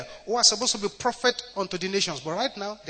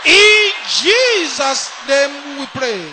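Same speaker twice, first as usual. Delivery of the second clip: preaching at full force; 17 dB louder than usual. Real, not acted. Shouted prayer.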